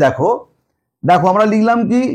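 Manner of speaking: ordinary delivery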